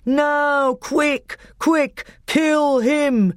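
urgent high-pitched yelling; crowd yell. A narrator's reading. Telmarines urging Miraz to finish Peter, high urgent yell. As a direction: egg the champion on from the ringside. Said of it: "they yelled".

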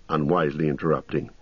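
ordinary talk

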